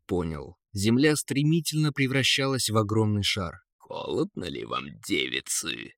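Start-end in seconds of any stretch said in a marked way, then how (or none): 3.8-5.9 low, soft taunting eagle; snide. A narrator's reading. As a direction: tease them quietly, deep in the voice. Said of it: "ехидно спросил Эрвин". (ехидно, низким голосом)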